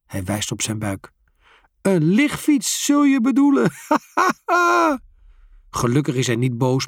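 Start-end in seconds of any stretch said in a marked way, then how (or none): 1.8-3.7 laughing joke. A narrator's reading. The father laughs at himself with the boys. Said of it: "begint hij keihard te lachen". 3.8-5.0 high, loud hearty guffaw; hearty laugh. A narrator's reading. The father bursts out laughing on the grass. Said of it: "keihard te lachen".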